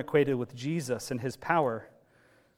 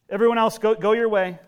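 louder and higher, sending people off; firm dismissal; 6 semitones higher, 9 dB louder, faster than usual. Retold or imitated. Jesus telling the crowd to go, loud. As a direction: wave them away with the voice.

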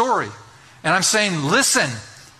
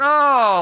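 a loud, high, weary moan; 6 semitones higher, 5 dB louder than usual. Retold or imitated. Superintendent moaning at the vote, loud and high. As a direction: groan it out for the whole room to hear. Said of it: "he moaned".

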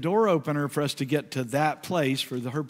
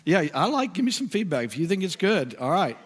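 ordinary talk, nothing marked

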